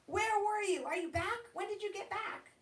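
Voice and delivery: high, eager tumble of questions; a seven-year-old thrilled to see her dad, voice jumping up high; excited child. Acted. Girl greets returning dad.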